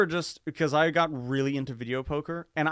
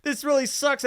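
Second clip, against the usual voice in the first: high, loud dismay; the sinking moment of the loss; dismayed oh shit; 11 semitones higher, 9 dB louder, much slower than usual. Retold or imitated. Down eight hundred, groaning loudly at his luck. Realizing he lost big.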